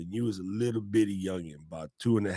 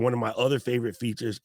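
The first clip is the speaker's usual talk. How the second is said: usual speaking voice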